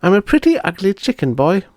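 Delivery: put-on a cross little girl in a chicken body. Bonnie looking in the mirror, high grumbling voice.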